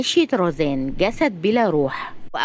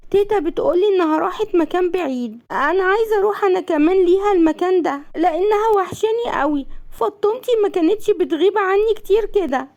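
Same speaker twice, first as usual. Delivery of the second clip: put-on high-pitched small child's voice; high, plaintive child voice; little child; 11 semitones higher than usual. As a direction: ask like a little kid missing mama. Narrator voices little boy Aser.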